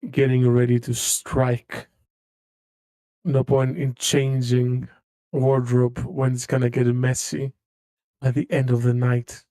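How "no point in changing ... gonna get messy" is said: ordinary delivery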